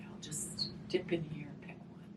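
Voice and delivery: hushed, secretive whisper; soft whisper. Acted. Teacher picking a feeling card for children, whispered. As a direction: whisper it as you reach in.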